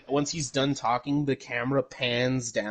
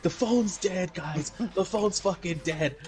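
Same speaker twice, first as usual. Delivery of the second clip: mock alarm; 6 semitones higher than usual. Retelling characters finding the phone dead, mock alarm. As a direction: imitate a movie character raising the alarm.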